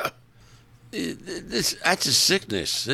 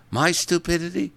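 mock offended question; 10 semitones higher than usual. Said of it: "She goes".

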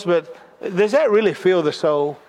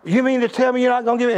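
fast retold yell; 5 semitones higher, faster than usual. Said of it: "he was yelling".

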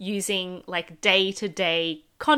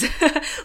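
light laugh; 8 semitones higher, 3 dB louder than usual. Real, not acted. Laughing about bougie trip.

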